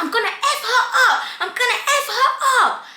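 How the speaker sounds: high-pitched mock shouting, no big volume; imitated shouting. Girl waving the wig and threatening, mock shouting. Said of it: "she's shouting, she's like".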